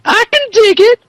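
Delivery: high mock female Larry voice. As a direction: squeak it out as a lady wrestler. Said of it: "the idea of a female Larry saying".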